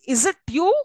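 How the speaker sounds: final "you" lifted as a question; tonic rise. Shown as a demonstration. Teacher showing the tonic syllable, rising stress on "you". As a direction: make "you" stand out with a rise. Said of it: "you is a tonic syllable".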